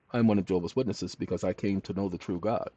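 usual speaking voice

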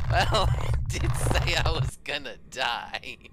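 loud, talking through laughter; laughing. Real, not acted. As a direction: laugh while saying it.

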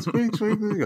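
high-pitched squeaks of a wheel; imitated squeaking cart. Teller mimics the rolling cart, high squeaky imitation. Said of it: "And it's going".

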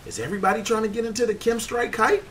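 high-pitched, incredulous question; incredulous complaint. Real, not acted. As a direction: pitch it way up in protest. Mock protest at crowded killstreak spot.

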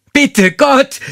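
shrill high panicked plea, loud; desperate cry. Acted. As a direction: cry out to God in terror. Terrified woman cries to God. (verzweifelt hoch und laut gefleht)

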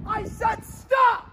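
loud, slow, high shout of stop; the yell a kid uses on a bully; commanding yell. Shown as a demonstration. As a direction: bark it like you mean it. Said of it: "you're practicing a ... voice".

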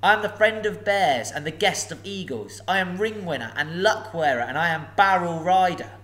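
enjoy your own cleverness; a hobbit pleased with his riddles; pleased boasting. Acted. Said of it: "beginning to be pleased with his riddling".

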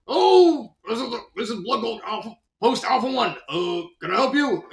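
high, hurried put-on soldier voice; hammed-up startled sergeant; flustered radio operator. A Red answers the radio.

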